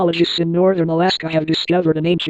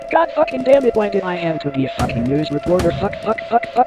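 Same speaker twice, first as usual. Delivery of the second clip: furious cursing; 3 semitones higher than usual. Acted. Reporter cursing before badger attack.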